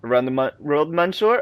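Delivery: normal talking voice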